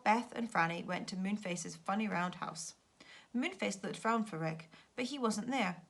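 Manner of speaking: ordinary delivery, nothing marked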